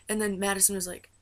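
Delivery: normal talking voice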